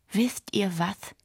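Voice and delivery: slow, deliberate resolve; determined. A narrator's reading. Yuki rallies the robbed friends, determined. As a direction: weigh each word, mind made up. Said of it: "Yuki klang plötzlich sehr entschlossen".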